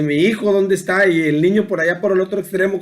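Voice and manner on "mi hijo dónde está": ordinary talk, nothing marked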